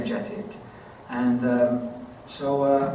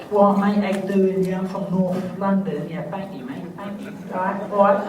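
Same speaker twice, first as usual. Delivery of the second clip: put-on higher-pitched North London geezer accent; 6 semitones higher, 4 dB louder than usual. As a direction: put on chirpy North London mate-talk. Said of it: "accent I picked up was from one of these".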